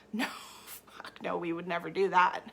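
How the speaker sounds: whispered aside with a laugh. Real, not acted. Coach answering her own question, whispered and laughing. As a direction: drop to a whisper, amused. Aside after mock scolding.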